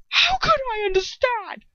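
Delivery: high, loud angry shout. A narrator's reading. Ron throwing Hermione's plea back at her, shouting. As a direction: shout it in outrage. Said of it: "he shouts".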